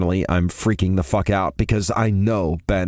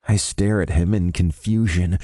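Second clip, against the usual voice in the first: plain reading voice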